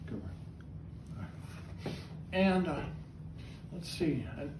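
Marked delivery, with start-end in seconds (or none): 0.1-1.9 breathy whisper to the bird; whispered praise. Real, not acted. Whispered praise after coughing.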